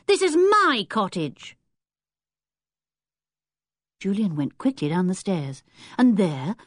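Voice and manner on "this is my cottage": put-on raised, slow and possessive, a boy defending his turf; indignant boy. Boy confronting intruders in his cottage, indignant. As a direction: stake your claim, offended.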